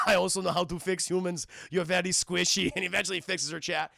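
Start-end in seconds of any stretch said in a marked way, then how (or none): 0.1-2.7 Austrian-accented cyborg impression; deadpan machine with an Austrian accent; Arnold. Cyborg reassuring Sarah as he fixes her, Austrian accent. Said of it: "Arnold's patching her up".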